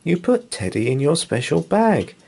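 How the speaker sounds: high-pitched, playful teasing without actual laughter; amused reassurance. A narrator's reading. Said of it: "You'd laugh and say".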